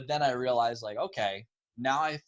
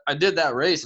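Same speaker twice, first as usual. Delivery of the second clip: ordinary talk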